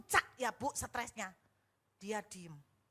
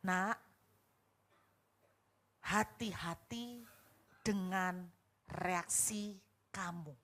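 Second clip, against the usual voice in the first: voice of God; 5 semitones lower, slower than usual. Retold or imitated. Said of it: "Tuhan bicara".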